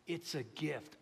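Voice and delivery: ordinary talk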